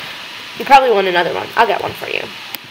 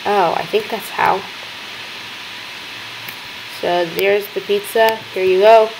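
ordinary talk, nothing marked

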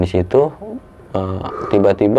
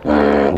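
low wordless growl; mimicked irritated "hmm" rumble; imitated annoyed growl; 5 semitones lower than usual. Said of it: "suara-suara kayak orang kesel ngegeram gitu".

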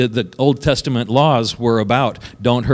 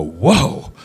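amazed whoa. Retold or imitated. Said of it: "People are gonna listen to you and go".

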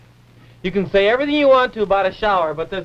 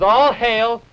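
grand proclamation; 4 semitones higher, 4 dB louder, much slower than usual. Acted. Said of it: "everybody bows low and says".

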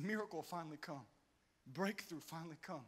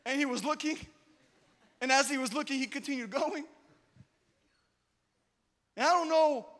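loud, high preacher cadence building on going and looking; fiery preaching, high and loud; preaching at full force; 8 semitones higher, 9 dB louder than usual. Acted. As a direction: belt it out like a revival preacher.

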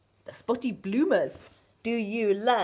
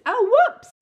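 whoops; 13 semitones higher, 11 dB louder than usual. A narrator's reading. T-Rex trips over pants.

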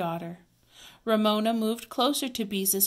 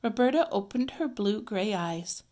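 plain reading voice

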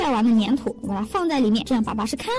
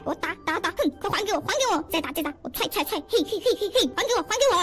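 quick bursts of play-fight cries, rising higher; mock fighting; 4 semitones higher, 5 dB quieter than usual. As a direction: punch out each hit in pretend combat. Acted fight, hitting and demanding back. (假装打斗的喊招声)

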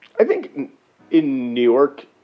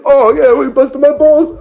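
loud, much higher hammed-up New York accent; New York tough guy; 12 dB louder than usual. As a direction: play a Sopranos-style New York mobster. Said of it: "I'm just thinking of The Sopranos".

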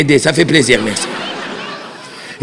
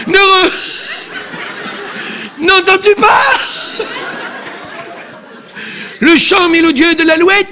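slow, higher, hammed-up tragic declaiming with a sob; drawn-out pleading Juliette calls to Roméo; melodramatic Juliette; 8 semitones higher than usual. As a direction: overplay Juliette's balcony lament. Juliette lines continue.

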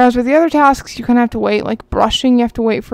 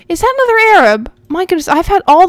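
high, louder excited question; excited surprise; 7 semitones higher, 5 dB louder than usual. Real, not acted. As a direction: exclaim it in real delighted disbelief. Spots another lucky rare horse.

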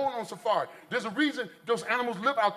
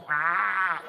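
long, low imitated wildcat yowl; drawn-out mock cat growl; bobcat snarl; 4 semitones lower than usual. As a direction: snarl like a bobcat after food. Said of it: "the cat go".

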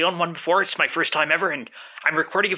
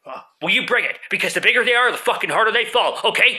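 arguing with myself; 3 semitones higher, 4 dB louder than usual. Acted. Threatening a fight.

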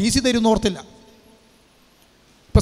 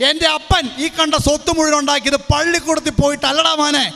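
higher, defiant father rallying his son; 7 semitones higher than usual. Acted. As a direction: stand up for your boy, half-shouting. Father defiantly comforting crying son. (മകനെ ചേർത്തുപിടിച്ച് വാശിയോടെ ഉയർന്ന ശബ്ദത്തിൽ അപ്പൻ)

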